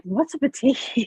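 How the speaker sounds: high, puzzled mock question; clueless question. Retelling her sister-in-law's batik talk, mock puzzled. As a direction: play the baffled newcomer. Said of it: "And I'm like".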